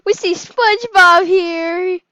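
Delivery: loud, high, slow girl imitation; girl's voice. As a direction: ham up a squeaky girl shouting. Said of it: "looks at me and says".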